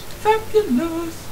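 slow, high drawn-out "Fabulous". Pretend. Teasing his co-player, camp fabulous drawl. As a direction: croon "Fabulous" high and long. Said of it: "I'm sounding fabulous right now".

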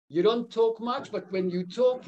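ordinary talk

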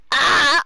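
high lovestruck wail; swooning cry. Acted.

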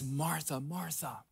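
soft, gentle calling of her name; tender address. Acted. Jesus gently calling Martha by name, softly and tenderly. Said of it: "There's tenderness in that address".

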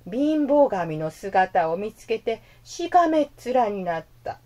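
ordinary delivery

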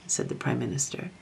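plain reading voice

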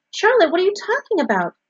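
high, loud, bewildered question; alarmed cry. A narrator's reading. Wilbur questions Charlotte about not going back, crying out. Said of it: "he cried".

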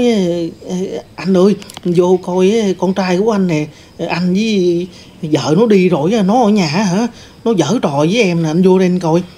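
mock-frightened cry calling the husband in; crying out for help. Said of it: "Rồi dì la lên".

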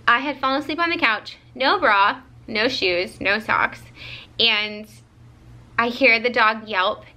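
usual speaking voice

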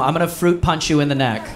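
ordinary talk

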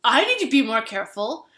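lightly voiced apologetic stranger; mock contrite. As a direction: imitate the spiller owning up. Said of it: "the guy who spilled the drink said".

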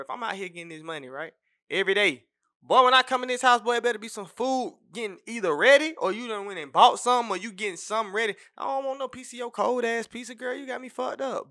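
heated rant. Real, not acted. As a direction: let it rip at her. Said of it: "let me tell you something, bro".

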